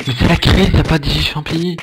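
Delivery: real alarm, up high and loud; alarmed outburst. Real, not acted. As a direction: panic about the empty food stock. Real panic, no food left.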